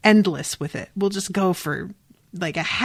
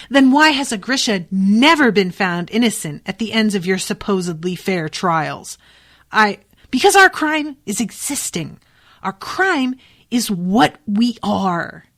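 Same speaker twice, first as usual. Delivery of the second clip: raised, heated protest of injustice; impassioned accusation; 3 semitones higher, 4 dB louder than usual. Acted. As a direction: act out an indignant retort, slowing for emphasis at the end. Acted bitter quarrel, Nina accusing.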